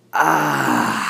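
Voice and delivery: low contented sigh of relief; relieved ahh. Shown as a demonstration. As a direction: breathe out in relief. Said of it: "when you feel relief".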